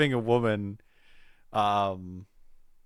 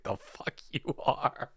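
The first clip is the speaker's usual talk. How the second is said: inner voice whisper; 3 semitones higher, 11 dB quieter than usual. Retold or imitated. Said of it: "my head was like".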